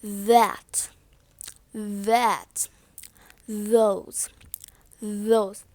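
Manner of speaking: "that" and "those" with a buzzing TH; low, soft model words, TH voiced in "that". Shown as a demonstration. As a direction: hold the vibration at the start of "those". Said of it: "Have you seen that vibration".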